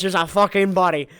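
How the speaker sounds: ordinary talk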